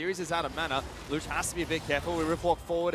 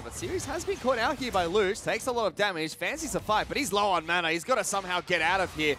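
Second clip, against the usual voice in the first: rising, louder hype as the kill lands; excited call; 4 semitones higher, 7 dB louder than usual. Real, not acted. Caster hyping a solo kill, loud and excited.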